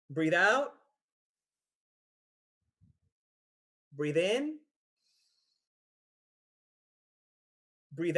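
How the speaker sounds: calm spaced-out breathing prompts; paced breathing cue. Shown as a demonstration. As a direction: lead the breath with long pauses.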